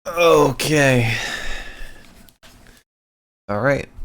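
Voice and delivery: usual speaking voice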